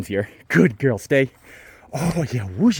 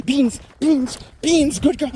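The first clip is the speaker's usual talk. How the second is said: high excited release call; 13 semitones higher, 4 dB louder, slower than usual. Real, not acted.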